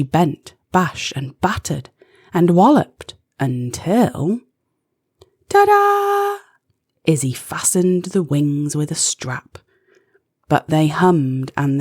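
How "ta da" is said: high, loud ta-da; showy fanfare ta-da; triumphant ta-da. A narrator's reading. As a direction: present it like a magic trick. Invention reveal.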